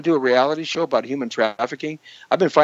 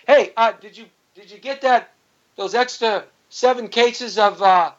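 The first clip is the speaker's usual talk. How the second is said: higher-pitched mock urgent check; pretend phone call; 7 semitones higher than usual. Host pretends to phone about survival supplies, raised voice.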